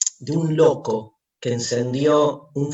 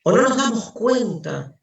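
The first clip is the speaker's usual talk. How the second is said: anguished madman; 7 semitones higher than usual. Acted. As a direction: ask it as if the ground is gone. Madman's rising questions.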